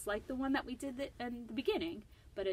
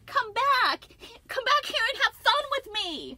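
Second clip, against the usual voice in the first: high, loud desperate calling; 10 dB louder than usual. Acted. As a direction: shout after them, pleading. Lucy calling after animals.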